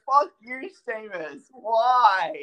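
high cursing rising to a loud cry; exasperated wail. Retold or imitated. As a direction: wail in mock anguish at the wrestler. Re-enacts anguish at Sheamus.

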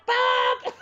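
shouting for Bob from the door; calling out. Retold or imitated. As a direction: yell his name to fetch him. Grandmother calls husband from doorway.

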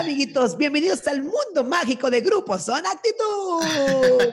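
hammed-up children's party host greeting; bright squeaky sing-song welcome. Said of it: "no quiero ser una botarga".

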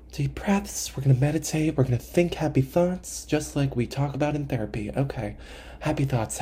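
soft self-soothing after a sigh; hushed, put-on therapy calm; mock meditation. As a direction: talk yourself down quietly.

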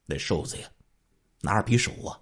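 plain reading voice

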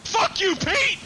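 high, loud jokey yell; mock yell. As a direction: yell it at Pete for a laugh. Joins mock yelling at Pete.

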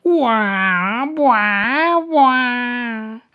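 high, loud howling sob; dragon wailing sobs. A narrator's reading. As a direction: wail like a crying dragon. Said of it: "que lloraba y gritaba".

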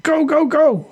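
high, urgent jump order; high-pitched yell, only mildly loud; pilot's yell. Acted. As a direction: yell the squad out of the aircraft. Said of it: "the pilot yelled".

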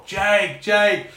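high, repeated holler; re-enacted shouting, pitched well up; imitated shout. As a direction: call the name down a long corridor. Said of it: "You're shouting through the corridor".

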